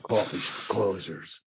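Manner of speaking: hushed menacing whisper; menacing unvoiced whisper; Alec Baldwin whisper. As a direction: breathe it like a cold salesman boss. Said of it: "Alec Baldwin bit".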